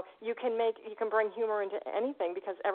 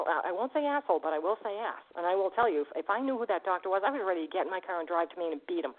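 ordinary delivery

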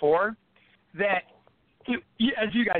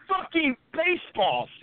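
higher, slow incredulous exclaim; 8 semitones higher, slower than usual. Real, not acted. Telling friends about the baseball walkout, stretched in disbelief. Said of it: "disbelief at the walkout".

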